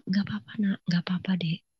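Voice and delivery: soft, almost whispered comfort; calm reassurance. Retold or imitated. Big sister comforting crying sibling, calm reassurance. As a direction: soothe very gently, barely voiced. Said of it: "Saya tenang sekali. ... Saya tenang aja".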